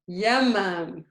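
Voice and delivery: Yamam begun on a Y glide. Shown as a demonstration. Teacher reading the word as if written with ya, Y onset. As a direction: ease into Yamam with "ya", no glottal catch. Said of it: "if it was with a ya".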